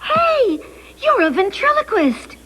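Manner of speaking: high, delighted exclamation; amazed discovery. Acted. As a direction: exclaim at a surprising trick.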